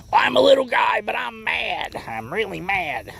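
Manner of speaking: high squeaky shout of a tiny angry fish, then dropping off; angry little fish. Pretend. Angler voicing his small catch, playful mock anger. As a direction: give the little bass a tiny furious voice.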